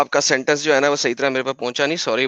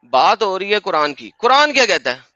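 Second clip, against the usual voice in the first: insistent; 6 semitones higher than usual. Real, not acted. Debater pulling opponent back to the Quran topic, insistent. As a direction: pitch climbing high in insistence.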